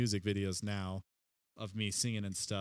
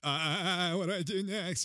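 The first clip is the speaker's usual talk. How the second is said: flustered high stammer; mock panic; 6 semitones higher than usual. Improv actor frozen on stage, mock panic. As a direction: play a lost improv actor. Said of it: "you're just like".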